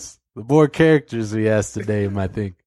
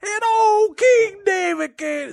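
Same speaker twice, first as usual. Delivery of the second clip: high storyteller voice; 9 semitones higher than usual. Pretend. Narrator character keeps escalating.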